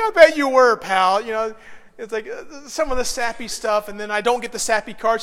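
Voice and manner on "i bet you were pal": loud sarcastic dig; mock sarcastic wife. Pastor voicing his wife teasing him, loud sarcasm. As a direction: throw a loud sarcastic jab.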